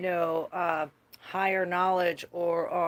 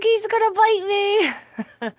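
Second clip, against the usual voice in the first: mock terrified squeal; 10 dB louder than usual. Grabbing the bat by hand, squealing in fear. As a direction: squeal it out in fright. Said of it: "I was like".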